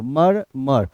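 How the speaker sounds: "मर, मर" in two separate, identical beats; syllable demonstration. Shown as a demonstration. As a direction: sound out "मर, मर" with a gap between. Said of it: "तो pronunciation से ही पकड़ लो". ("मर, मर" दो अलग सिलेबल में बोलना)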